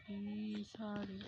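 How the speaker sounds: low, soft urging; quiet plea. Real, not acted. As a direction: plead under your breath. Same plea, now under breath.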